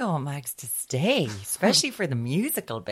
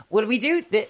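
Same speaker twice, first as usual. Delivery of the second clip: ordinary talk, nothing marked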